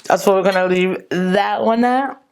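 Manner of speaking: ordinary talk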